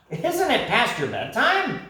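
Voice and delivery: high cheeky parrot voice; teasing parrot. Acted. Parrot teasing the night creatures, high and cheeky. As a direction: poke fun in a high voice.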